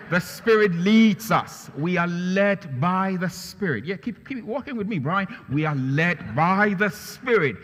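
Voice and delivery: loud, high preaching; impassioned sermon voice; fervent preaching. Acted. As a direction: drive the point home from the pulpit. Preaching with demonstration.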